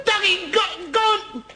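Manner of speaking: high, loud call to a dog. Acted. Hunter urging his dog to fetch, high loud calling. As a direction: shout it out across the lake.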